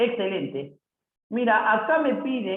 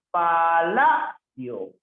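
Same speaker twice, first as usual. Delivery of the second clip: "palacio" drawn out with a loud LA; stress on the penultimate syllable; 5 dB louder, much slower than usual. Shown as a demonstration. Teacher showing a palabra grave, "palacio" slow with stressed LA. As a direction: stretch "palacio" slowly, punching the LA. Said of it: "es una palabra grave".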